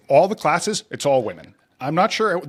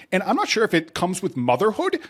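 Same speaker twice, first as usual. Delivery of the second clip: ordinary talk, nothing marked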